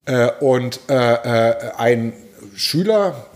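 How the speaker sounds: normal talking voice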